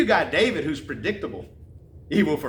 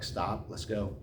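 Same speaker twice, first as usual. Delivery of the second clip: wife's soft plea; 6 semitones lower, 9 dB quieter, slower than usual. Retold or imitated. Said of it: "she's like".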